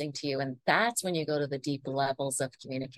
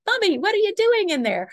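put-on high, loud kid calling through a door; imitated little child; 8 dB louder than usual. Mom imitating her little ones at the office door, child voice. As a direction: pipe up like a nosy little one. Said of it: "there would be little knocks on the door".

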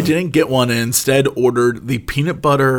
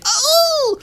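delighted surprise. Retold or imitated. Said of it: "I'd be like".

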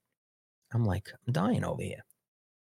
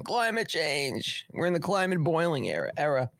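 hammed-up fake distress; mock crying; 6 semitones higher than usual. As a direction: whine it like you're mocking protesters. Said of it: "and they're all crying".

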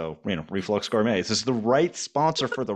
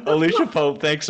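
laughing out her name, high; 6 dB louder than usual. Real, not acted. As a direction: laugh while saying the name. Host laughing at sign-off.

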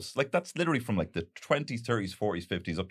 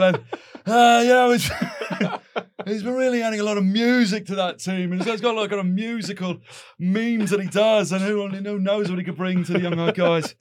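higher, louder parody pundit; mock football pundit; 7 semitones higher, 8 dB louder than usual. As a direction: chew over the transfer like an excited pundit. Plays pundit in transfer parody.

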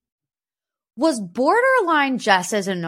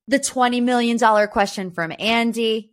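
usual speaking voice

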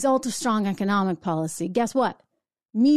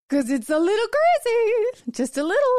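mocking singsong; 13 semitones higher than usual. Real, not acted. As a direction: poke fun in a much higher singsong voice. Mocking Clinton's deprogramming remark.

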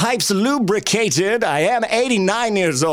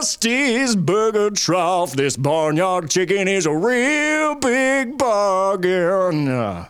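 Tommy Birthday voice; much slower than usual. Acted. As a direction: croon the ad like a soothing old host. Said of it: "do it in the Tommy Birthday voice".

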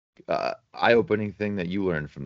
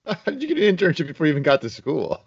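laughing disbelief; 11 semitones higher than usual. Real, not acted. Cohost laughing at the story.

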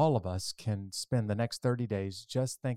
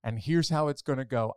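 usual speaking voice

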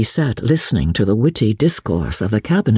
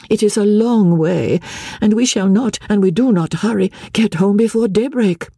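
a hint of a tired lady, higher; lightly voiced Lady Blakeney; 7 semitones higher than usual. Marguerite voiced by narrator.

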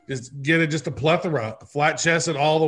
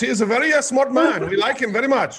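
higher, hammed-up Indian accent; 9 semitones higher than usual. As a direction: chime in with a put-on Indian accent. Joins the Indian accent bit.

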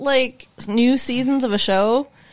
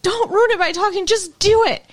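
urging the screen; 10 semitones higher than usual. Real, not acted. Yelling at TV characters.

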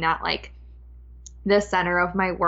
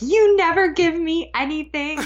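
higher, whiny mock outburst of a little boy; imitating a kid's tantrum; 8 semitones higher, 3 dB louder than usual. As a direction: act out a child flipping out over ice cream. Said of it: "he flipped out and was like".

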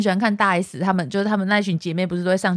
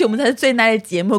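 ordinary talk

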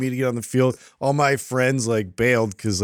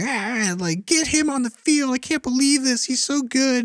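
high, whiny imitation of a mom; mock pushy mom; 12 semitones higher than usual. Mom begging the coach for playing time, high and whiny. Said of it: "your mom talking to the coach and being like".

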